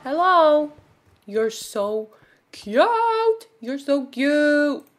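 loud, high squeal on cute; gushing coo. Real, not acted. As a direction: coo adoringly at a cute creature. Cooing at a cute creature.

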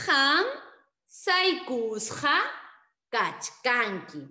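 Han saikusqa kachkanki very slow, loud start; slow model answer. Shown as a demonstration. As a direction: stretch saikusqa for pupils. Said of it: "la respuesta será".

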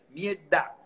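"Mierda" with the R dropped, the d held long; Cuban accent mierda. Shown as a demonstration. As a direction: say "Mierda" with a sustained d. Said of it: "quita la R y pone la consonante sostenida". ("Mierda" cubano, d sostenida)

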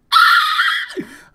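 howling laugh that falls away; loud burst of laughter. Real, not acted. Streamer bursts out laughing at losing his Pokemon. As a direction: crack up loud at your own mistake. Huge laugh at own blunder.

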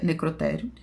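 usual speaking voice

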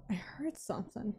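wary, very soft whisper; barely audible, on alert; hushed whisper. Real, not acted. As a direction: whisper it while listening out. Listening out in creepy game.

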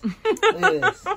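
high squeal through laughter; excited laughing squeal. Real, not acted.

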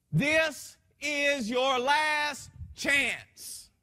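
slow, booming voice of God; a commanding divine voice, drawn out; authoritative command. Retold or imitated. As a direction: thunder it out slowly like a final warning. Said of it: "said with so much authority and so much vigor".

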